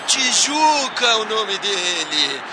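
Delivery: high, ringing calls of the scorer's name; explosive goal commentary. Acted. (grito do nome do artilheiro)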